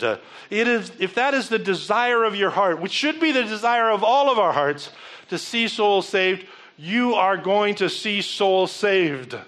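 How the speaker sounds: slow, emphatic preaching build. Acted. Preacher urging the church to win souls, slow and emphatic. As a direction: drive it home to the congregation.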